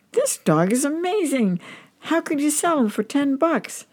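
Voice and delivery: high-pitched acted astonishment; stunned amazement. A narrator's reading. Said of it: "The guy is stunned".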